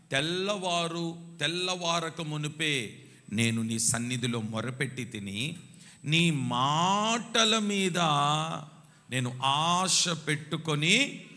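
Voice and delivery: loud, slow, drawn out verse; fervent scripture reading. Acted. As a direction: proclaim the verse. (గట్టిగా, నెమ్మదిగా వచన పఠనం)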